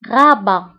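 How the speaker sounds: غابة as French ra-ba, uvular r, no t; French Rabat in غابة, final t left silent. Shown as a demonstration. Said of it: "ولكن لا تنطق".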